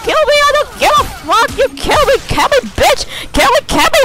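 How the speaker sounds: very high-pitched, squealing triumph; gleeful taunting. Real, not acted. Gamer daring opponents to attack after scoring, giddy screech. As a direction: screech it giddily, daring them.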